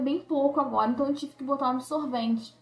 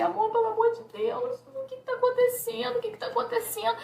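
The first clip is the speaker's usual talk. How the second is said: high, frantic plea to her husband; begging in panic, pitched much higher; terrified wife pleading with her husband, high and frantic; panicked crying plea; 10 semitones higher than usual. Retold or imitated. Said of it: "eu comecei a chorar".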